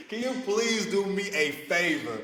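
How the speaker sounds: high, loud, drawn-out begging; excited pleading. Real, not acted. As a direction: plead slowly, giddy with laughter. Excited pleading after hearing the accent.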